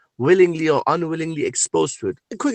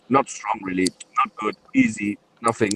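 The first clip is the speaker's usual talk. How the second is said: mocking dismissive voice; 9 semitones lower, 5 dB quieter than usual. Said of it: "All they can say to their followers is".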